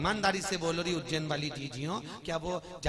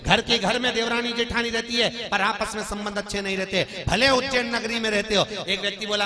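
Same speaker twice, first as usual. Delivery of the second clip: loud, higher sermon delivery; fiery preaching; 8 semitones higher, 12 dB louder than usual. Acted. Storyteller preaching to the Ujjain women, loud and high. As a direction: proclaim it to the whole crowd.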